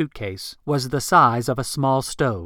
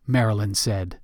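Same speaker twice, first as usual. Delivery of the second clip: ordinary delivery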